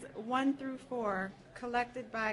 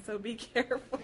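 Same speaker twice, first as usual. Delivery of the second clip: laughing through it; faster than usual. Real, not acted. Laughing at the love spell.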